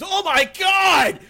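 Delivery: full-out high horrified scream. Acted. Man finding a body beside him, screaming. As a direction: scream it in total shock. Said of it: "he jumps up and screams".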